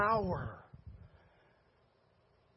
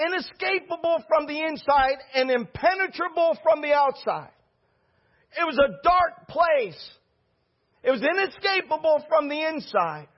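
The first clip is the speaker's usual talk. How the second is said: higher, building preacher's cry; full-force preaching; 6 semitones higher than usual. Acted. The preacher tells the church about Peter's prison, preaching at full force. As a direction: drive it home to the congregation.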